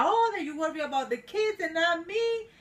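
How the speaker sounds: angry retort. Retold or imitated. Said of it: "he got more angry because he was like".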